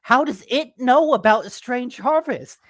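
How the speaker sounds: high, loud, baffled questioning; incredulous re-enacted outburst; retold astonishment. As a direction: act out alarmed disbelief, voice jumping up. Retold shock at real text message.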